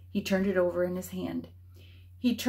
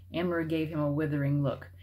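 plain reading voice

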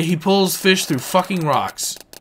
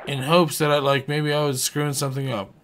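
ordinary delivery

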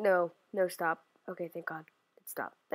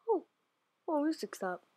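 ordinary talk, nothing marked

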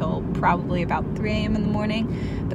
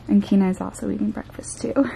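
usual speaking voice

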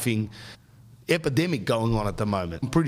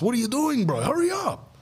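much higher impatient call through the glass; high mock brother-in-law; 12 semitones higher than usual. Brother-in-law at the glass, impatient high call. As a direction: squeak out an impatient hurry-up.

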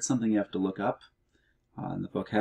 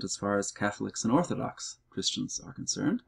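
ordinary delivery

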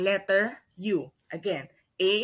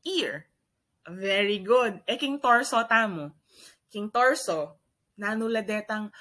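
usual speaking voice

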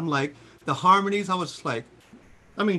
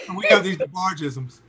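normal talking voice

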